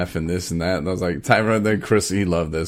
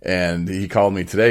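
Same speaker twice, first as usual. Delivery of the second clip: ordinary delivery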